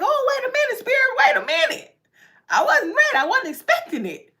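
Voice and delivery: high, flustered cry of surprise; startled squeal of surprise; caught off guard. Retold or imitated. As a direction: act out being caught unready.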